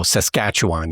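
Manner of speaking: Saskatchewan stressed on the second syllable, rest let go; sasKATCHewan, everything else tossed away; casual local Saskatchewan; local pronunciation. Shown as a demonstration. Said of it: "emphasis on the second syllable".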